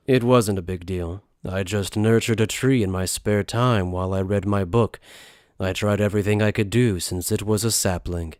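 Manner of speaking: low, understated character voice; downplay your feat, pitched low; unassuming book wielder. Acted. Voices the tree-altering bookman.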